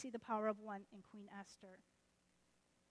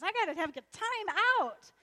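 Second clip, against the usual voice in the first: loud, high, worked-up outburst; enraged king; 8 semitones higher, 11 dB louder than usual. Acted. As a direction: burst out, too incensed to stay. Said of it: "The king goes into a rage".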